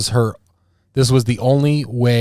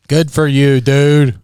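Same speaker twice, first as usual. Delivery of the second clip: louder, higher repeat; loud emphatic; 3 semitones higher, 7 dB louder, much slower than usual. Real, not acted. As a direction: say it again with gusto.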